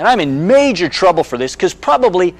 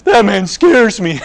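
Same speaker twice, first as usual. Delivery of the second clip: put-on higher, slow, frightened child voice; scared little girl; 4 semitones higher, 4 dB louder, slower than usual. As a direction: whimper it like a small girl clinging to dad. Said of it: "She goes".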